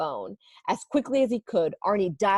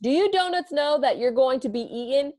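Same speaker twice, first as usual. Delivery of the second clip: raised voice across the room; calling out; 6 semitones higher, 3 dB louder than usual. Acted. Baker calls across the bakery to the donuts, raised voice. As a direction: call out to the donuts. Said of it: "The baker yelled".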